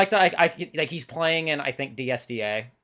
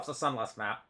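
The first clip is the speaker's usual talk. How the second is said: ordinary talk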